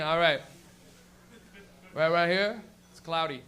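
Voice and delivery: low, lazy forecast with pauses; stoned weatherman. Acted. Weatherman who smoked weed points out clouds, stoned low voice. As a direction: give the forecast as a laid-back stoner. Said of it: "somebody who smoked weed would be the weatherman".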